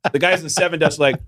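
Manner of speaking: ordinary delivery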